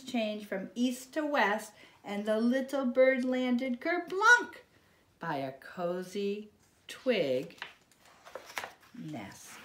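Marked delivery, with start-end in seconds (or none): none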